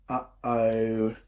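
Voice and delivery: low, dark uh-oh; ominous uh-oh. Acted. Storyteller building suspense for kids, low uh-oh. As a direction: drop the voice for suspense.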